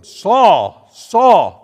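loud, high calling of the name; voice from heaven. Acted. Voice of Jesus calling Saul on the road, loud. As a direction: call out like a voice from the sky. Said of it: "heard a voice say to him".

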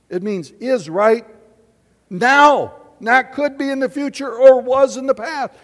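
loud, high, emphatic preaching; fervent pulpit proclamation. Acted. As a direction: preach it out with full force. Preaching at full force.

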